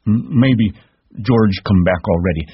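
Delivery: hope it desperately; frightened stammer. A narrator's reading.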